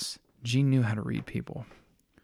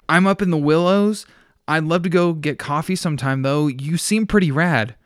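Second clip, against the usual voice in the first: excited; 6 semitones higher, 9 dB louder than usual. Acted. Deja flirting, excited.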